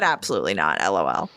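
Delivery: sarcastic low monotone turning down the Venmo idea; deadpan refusal. Retold or imitated. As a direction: drop the voice way down, flat as a board. Her flat refusal to send Venmo.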